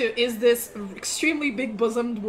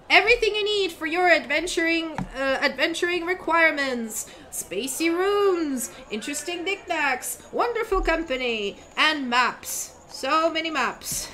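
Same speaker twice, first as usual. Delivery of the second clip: high, bright-voiced young crier calling out to travelers; eager town crier kid; 5 semitones higher than usual. GM as the kid crier announcing inns to travelers, high and proclaiming. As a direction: hawk the inns like a paid teenage crier.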